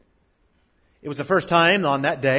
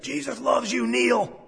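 raspy voice; 12 semitones higher, slower than usual. Retold or imitated. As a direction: bellow it over the fallen quarterback. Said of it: "in that famous raspy voice".

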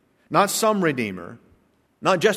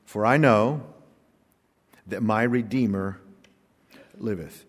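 scripture reading; 4 semitones lower than usual. Acted. Preacher reads Job's verse to the congregation, solemn and fading. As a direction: read the verse reverently, letting it fall away.

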